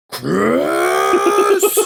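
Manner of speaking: long, high, exasperated holler; hammed-up drawn-out yell for a troublemaker; Mister Wilson. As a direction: stretch the name out, rising, as if calling over party music. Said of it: "Like Mister Wilson trying to get a hold of Dennis the Menace".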